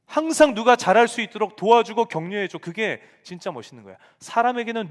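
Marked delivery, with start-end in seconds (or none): 0.1-2.6 loud, high fatherly urging; urging his kids. Retold or imitated. Father telling his children to encourage others, loud and high.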